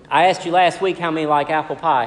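ordinary talk, nothing marked

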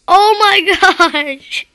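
slow, drawn-out words through laughter; laughing. Real, not acted. Gamer cracking up as his head falls off.

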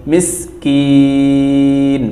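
the last vowel of মিসকিন stretched three beats; long vowel held. Shown as a demonstration. Teacher to class, vowel drawn out. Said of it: "তিন আলিফ টানতে হবে".

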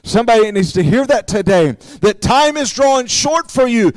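ordinary talk, nothing marked

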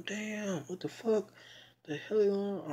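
high, startled exclamation; shocked reaction. Retold or imitated. Teller voicing his shock at the plan, high startled exclamation. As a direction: react high, as if hit by the news. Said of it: "I can imagine how she was feeling".

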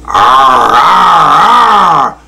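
long rising storybook roar; crocodile roar. A narrator's reading. Crocodile with monkey on her back, roaring. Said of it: "roared Cordelia".

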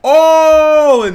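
ordinary talk, nothing marked

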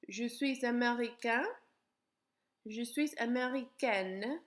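teacher modelling pronunciation; repeat-after-me drill. Shown as a demonstration. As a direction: say each form for students to echo. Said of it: "you can repeat after me".

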